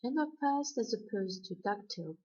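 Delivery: normal talking voice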